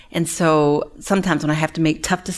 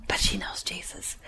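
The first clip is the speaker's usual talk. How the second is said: hushed whisper of comfort; 13 dB quieter than usual. Real, not acted. Grief telling of death.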